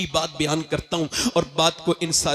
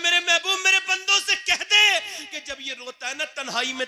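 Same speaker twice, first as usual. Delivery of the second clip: fervent climax; 10 semitones higher than usual. Acted. Climactic divine address.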